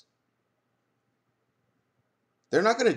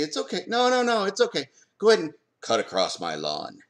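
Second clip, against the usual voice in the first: easygoing homeowner; 8 semitones higher than usual. Pretend. Homeowner inviting a neighbor across his lawn, breezy and high. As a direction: wave them across the lawn, playing it casual.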